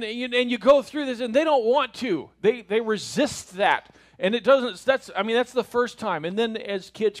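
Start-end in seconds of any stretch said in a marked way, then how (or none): none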